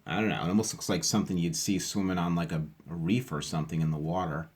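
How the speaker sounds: usual speaking voice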